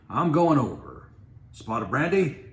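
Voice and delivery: loud, high, dashing captain; bold bravado. Acted. Captain Mitty to the sergeant, loud heroic bravado. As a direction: toss it off heroically.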